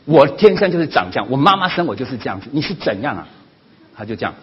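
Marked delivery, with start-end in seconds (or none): 0.0-3.3 loud, fast fed-up comeback; angry retort. Retold or imitated. Mother who can't take it anymore retorts to her son, mildly angry. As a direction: blurt it out, losing patience. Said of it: "他说".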